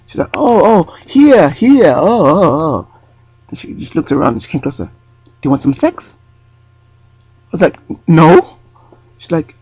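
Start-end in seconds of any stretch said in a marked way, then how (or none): 0.3-2.9 high, louder dawning oh in a put-on accent; European accent. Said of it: "try and do an accent". 5.2-6.1 very high, sly offer in a put-on accent; woman propositioning him at the bus stop, mock European accent. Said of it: "try and do an accent". 8.1-8.6 firm no. Retold or imitated. Refusing proposition.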